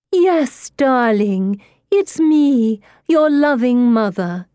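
high, loud, syrupy reassurance; sweet spirit-mother. A narrator's reading. Medium pretends to be the girl's dead mother, high gushing coddling. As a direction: croon it high as a doting mother.

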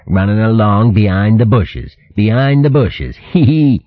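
taunting copycat with a snicker; nasty mimicry. A narrator's reading. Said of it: "mimicking his voice in a nasty manner".

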